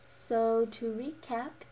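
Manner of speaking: ordinary talk, nothing marked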